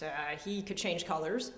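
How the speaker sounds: ordinary delivery, nothing marked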